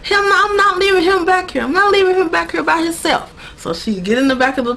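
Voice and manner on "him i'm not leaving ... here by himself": high-pitched, urgent acted protest; insistent refusal. She refuses to leave him back there, acted insistent. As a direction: dig your heels in, voice pitched up.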